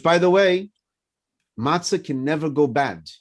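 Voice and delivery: ordinary talk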